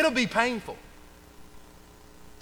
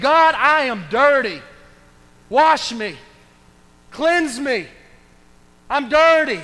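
slow, louder heavy confession; 3 semitones higher, 4 dB louder, slower than usual. Acted. David confessing his sin to God, slow and heavy. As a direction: lay the shame bare before God.

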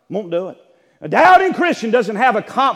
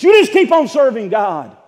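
loud exhortation from the pulpit; preaching shout; 6 semitones higher, 10 dB louder than usual. Real, not acted. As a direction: shout it out to the congregation.

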